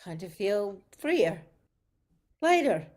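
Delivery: usual speaking voice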